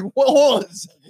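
mock startled voice. Voicing the kicked kid.

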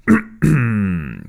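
loud, long official throat-clear; drawn-out rumbling ahem. A narrator's reading. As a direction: clear your throat pompously before a pronouncement. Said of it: "megköszörülte a torkát".

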